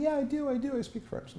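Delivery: high, soft mock protest from a girl; imitated protesting girl. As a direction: insist in a mock girly high voice. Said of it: "She's like".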